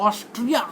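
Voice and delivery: "ऑस्ट्रिया" with the middle syllable squashed; altered pronunciation. Shown as a demonstration. Teacher showing a differently spoken country name, altered "ऑस्ट्रिया". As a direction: misread Australia as "ऑस्ट्रिया". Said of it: "हर word को अलग अलग".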